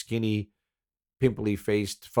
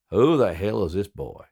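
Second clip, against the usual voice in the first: mocking Southern good-old-boy accent; loud, incredulous Southern local; Southern guys; 3 semitones higher, 4 dB louder than usual. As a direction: heckle in an exaggerated Southern accent, voice climbing. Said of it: "the guys were hard on me".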